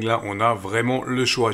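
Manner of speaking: ordinary delivery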